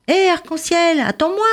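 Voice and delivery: high calling little fish voice; high little voice calling after him; small fish calling. Acted.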